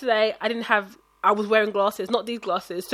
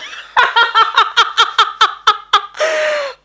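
loud high burst of laughter; real helpless laugh; big laugh; 10 dB louder than usual. Real, not acted. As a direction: crack up at your own joke. Laughing at her own joke.

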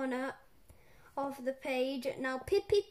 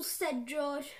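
ordinary delivery

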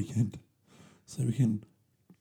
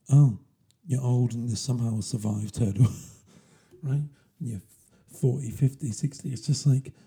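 ordinary delivery

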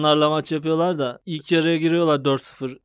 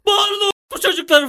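much higher, louder hammed-up drummer shout; mock drummer yell; 4 dB louder than usual. Stadium drummer goading the crowd, hammed-up high yell. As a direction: bark it like a fat shirtless stadium drummer. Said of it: "falan diyor".

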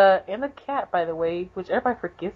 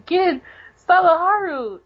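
higher happy calls of the names; mock cheer; 8 semitones higher than usual. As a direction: call out gladly, like a delighted kid. Kagura cheering in retold scene.

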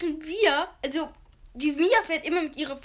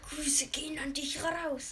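leise gehaucht, langsam; flüsternd; 9 dB quieter, slower than usual. Pretend. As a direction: wie ein Geheimnis flüstern. Grüße an Mitschüler. (flüsternd, langsam)

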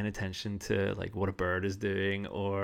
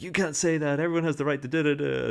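higher, louder, fast mock-scold; mocking outrage; 6 semitones higher, 5 dB louder, faster than usual. Podcaster mocking cancel-culture critics, sneering mock outrage. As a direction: parody a finger-wagging critic.

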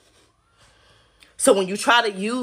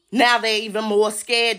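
normal talking voice

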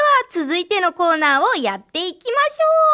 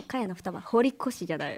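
normal talking voice

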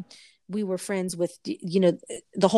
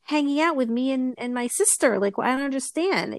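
higher-pitched, hurt pleading; retold upset protest in a raised pitch; upset child; 6 semitones higher than usual. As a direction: plead like a kid being left out. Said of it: "I was so upset".

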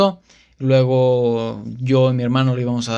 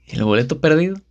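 sheepish; 3 semitones higher, faster than usual. Retold or imitated. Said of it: "con la cara toda para abajo".

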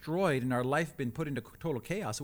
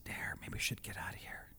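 hushed, much softer whisper; 5 semitones lower, 12 dB quieter than usual. Retold or imitated. Wife whispers to husband in the silence after the tornado. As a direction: whisper it as if the danger is still near.

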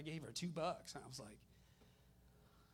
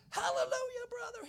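very high, louder mock call from afar; excited holler; 8 dB louder than usual. Grateful man hollers to the donor driving off, excited holler. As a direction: holler it out high, drawn and joyful. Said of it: "He was so excited".

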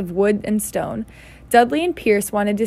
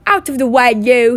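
rough shove; 3 semitones higher, 7 dB louder than usual. A narrator's reading. Bully shoves Harry aside.

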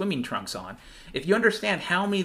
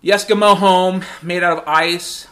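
ordinary talk

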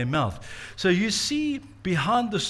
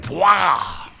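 mock burst; 7 dB louder than usual. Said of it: "their brain went".

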